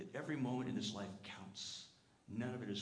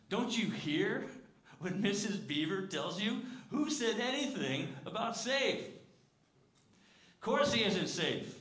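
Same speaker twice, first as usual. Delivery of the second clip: higher, louder and slow, almost shouting; booming Mister Beaver; 9 semitones higher, 8 dB louder, slower than usual. Retold or imitated. Mister Beaver booming at Lucy about Aslan, slow and loud. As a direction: boom it out like a big-hearted beaver scolding a child. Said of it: "said Mister Beaver".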